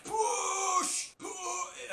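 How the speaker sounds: high shrill yelled push; Patrick yelling push. Retold or imitated. Fan imitating Patrick yelling at a worm. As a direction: bellow it like Patrick straining. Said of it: "Patrick's like".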